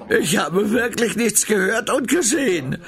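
close to tears. A narrator's reading. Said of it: "den Tränen nahe".

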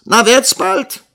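high, loud demand; impatient yell. A narrator's reading. Schoolmaster hurrying the sleeping pupils, impatient yell. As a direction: hurry them up with a shout.

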